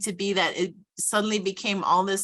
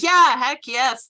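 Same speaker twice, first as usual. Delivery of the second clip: louder, higher emphatic agreement; wholehearted, raised-voice acceptance; resounding yes; 5 semitones higher, 8 dB louder, slower than usual. Retold or imitated. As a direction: recall an eager, drawn-out yes. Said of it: "like, resounding yes".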